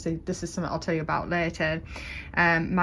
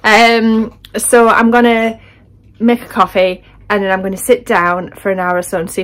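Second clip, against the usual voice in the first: much louder, still windswept; 3 semitones higher, 13 dB louder than usual. Real, not acted. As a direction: talk loud after the wind. Just back from windy beach.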